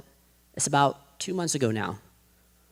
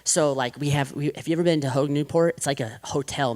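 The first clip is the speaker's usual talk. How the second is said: usual speaking voice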